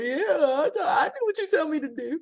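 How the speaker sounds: laughing, high and meek mock yes-ma'am; mock submissive. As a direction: play the sweetly obedient wife through a laugh. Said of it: "I might be a little submissive".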